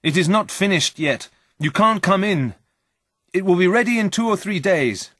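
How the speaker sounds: high, urgent cry of alarm; panicked cry. A narrator's reading. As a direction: call out in panic to keep them out. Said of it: "I cried".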